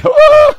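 high, loud preacher's whoop; exultant whoop. Real, not acted. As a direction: let out a sudden ecstatic holler. Overcome while describing God's presence.

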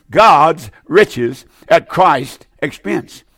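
very slow, each word weighed; slow, deliberate teaching delivery; slow, spelled-out definition. Shown as a demonstration. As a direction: dictate it slowly so they can note it. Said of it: "I hope you'll write that down".

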